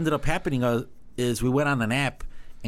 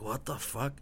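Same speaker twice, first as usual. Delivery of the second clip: muttered under the breath, much softer; hushed retold swear; 10 dB quieter, slower than usual. Stunned reaction in retold scene.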